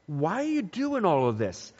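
ordinary delivery

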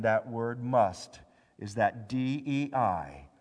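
ordinary talk

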